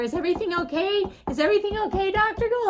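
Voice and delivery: high, loud mock-worried calling; alarmed nurses. Nurses knocking on doctor's door, alarmed. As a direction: call out urgently, imitating worried nurses.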